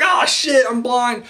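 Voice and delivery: high panicked referee cry; mock blinded referee. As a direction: play the referee caught off guard. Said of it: "the referee's like".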